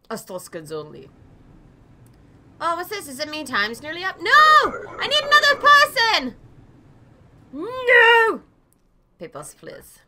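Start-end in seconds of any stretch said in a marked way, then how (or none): 4.2-8.4 high, loud wail of refusal; hammed-up protest. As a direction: yell as if time is running out. Protesting as game timer runs out.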